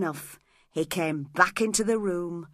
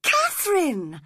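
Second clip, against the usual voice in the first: Catherine! called out louder and higher, falling; shocked cry; 10 semitones higher, 4 dB louder than usual. A narrator's reading. Edgar crying out at Catherine, loud and high. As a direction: cry her name out in alarm. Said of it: "cried Edgar, shocked".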